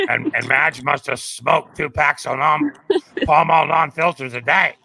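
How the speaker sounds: imitating a gruff AA phone gatekeeper; Madge impression. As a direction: rasp it out like a two-pack-a-day smoker. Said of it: "she talked like this".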